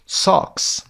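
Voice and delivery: socks with an open, long "ah"; long vowel sound. Shown as a demonstration. Teacher shows the odd word out, socks with open "ah". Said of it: "socks صدای آی بلند داره".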